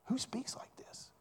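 hushed, awed whisper of a teacher; amazed whisper. Retold or imitated. Teachers in the temple marvel at the boy, whispering. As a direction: whisper it in disbelief.

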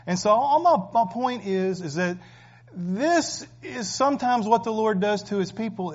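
fervent pulpit preaching; full-force preaching. Acted. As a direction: preach it out to the congregation.

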